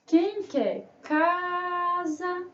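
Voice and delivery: slow singsong sales cry with a long "ca"; drawn-out, chanted vendor's cry; street vendor's call. Pretend. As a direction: hawk it like goods at market. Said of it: "Vamos vender essa palavra?".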